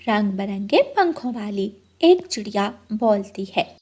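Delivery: usual speaking voice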